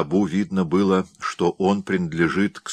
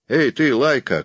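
higher, mildly raised hailing call; 7 semitones higher, 3 dB louder than usual. A narrator's reading. Bill hails the strange wolf like a dog, calling out. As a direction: hail the animal in a higher voice. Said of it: "крикнул он".

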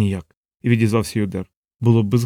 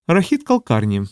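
higher-pitched musing; thoughtful; 6 semitones higher than usual. A narrator's reading. Savitri reflects on the administrator, thoughtfully. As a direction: muse on the name in a raised voice. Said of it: "задумливо мовила Савітрі".

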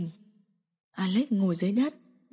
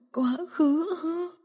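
tearful bitter question; 7 semitones higher, 4 dB quieter, much slower than usual. Acted. Bitter broken woman.

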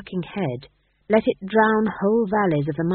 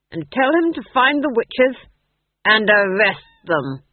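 loud order, slowing on the last words; commanding duke; 4 semitones higher, 7 dB louder than usual. A narrator's reading. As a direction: hand down a ducal command.

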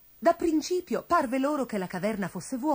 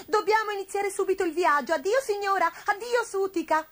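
high little cat voice, eager to leave; hurried farewell; 10 semitones higher, 3 dB louder than usual. A narrator's reading. Gobbolino heading for the cave exit, hurried. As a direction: call goodbyes pitched well up.

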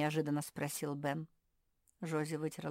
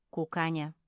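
plain reading voice

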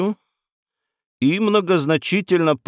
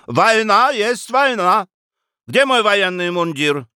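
shouting after them; 8 semitones higher than usual. Acted. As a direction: shout down the corridor. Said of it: "продолжал кричать им вслед". (кричит вслед уходящим)

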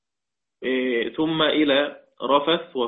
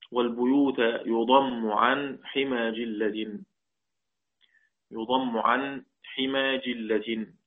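verse recitation; much slower than usual. Shown as a demonstration. Teacher recites a line of the Shatibiyya.

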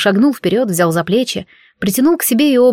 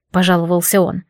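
ordinary delivery

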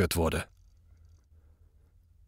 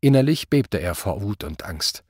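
usual speaking voice

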